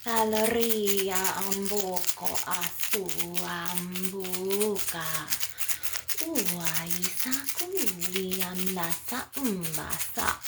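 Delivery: slow, drawn-out chant with long vowels; channelled light language. Acted. Channelling Mintakan and Andromedan star mothers, slow chanting. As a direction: stretch each syllable into a flowing incantation.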